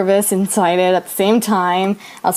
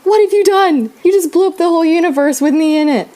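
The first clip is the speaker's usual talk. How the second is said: panicked shriek; 8 semitones higher, 3 dB louder than usual. Retold or imitated. Panicking after friend sent text.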